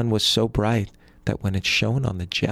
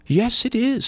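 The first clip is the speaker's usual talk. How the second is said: much higher eager voice; high-pitched poor brother; 13 semitones higher than usual. A narrator's reading. Poor brother answering the sun.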